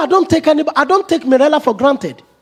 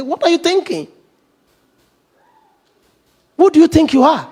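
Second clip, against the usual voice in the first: higher, sharp demanding challenge; stern rebuke; 7 semitones higher than usual. Retold or imitated. Preacher voicing people confronting a proud friend, stern rebuke. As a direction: confront them like a scolding elder.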